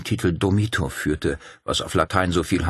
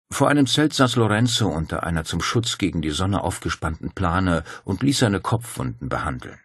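usual speaking voice

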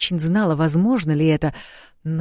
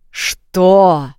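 loud roaring what; 8 dB louder than usual. A narrator's reading. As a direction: roar it out like a king. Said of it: "взревел король".

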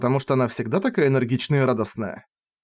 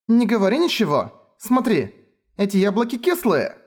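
put-on flustered girl; 8 semitones higher than usual. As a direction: rush to cut him off.

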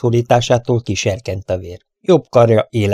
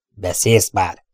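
understated hateful demand; deep hatred; slower than usual. A narrator's reading. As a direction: press the captive with contained loathing. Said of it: "kérdezte feneketlen gyűlölettel". (visszafogott gyűlölettel)

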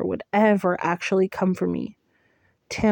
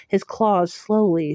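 usual speaking voice

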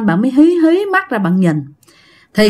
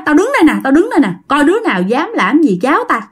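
higher-pitched protective warning; retold defiance, raised in pitch; fierce uncle; 5 semitones higher, faster than usual. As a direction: imitate an uncle challenging unseen threats. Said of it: "mặt bác hai dữ lắm".

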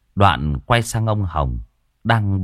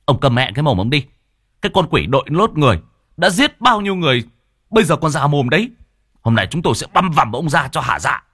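higher, louder and fast furious yell; angry villager; 8 semitones higher, 3 dB louder than usual. A narrator's reading. Villager curses the old man, furious. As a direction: spit hatred at the accused. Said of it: "tức giận chỉ tay".

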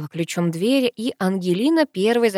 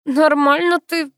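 strained, high and loud after choking; wheezed; 9 semitones higher, 5 dB louder, slower than usual. A narrator's reading. Sister chokes at the lie, wheezed. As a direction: squeeze the words out slowly. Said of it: "прохрипела сестра".

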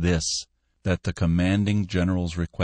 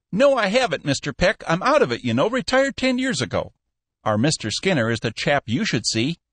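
put-on higher, genial old businessman; an old boss kindly waving him off; lightly voiced old man; old Cappy voice; 10 semitones higher than usual. Old Cappy answering Peck.

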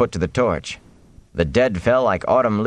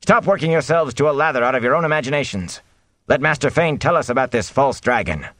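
raised-pitch scolding of a crowd; mayor's shout; 8 semitones higher than usual. A narrator's reading. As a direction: call them firmly to order. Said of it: "the mayor shouted".

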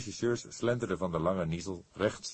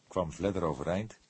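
ordinary delivery

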